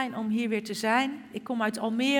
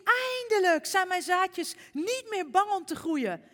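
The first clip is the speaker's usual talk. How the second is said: excited; 9 semitones higher, 3 dB louder than usual. Acted. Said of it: "Pat riep".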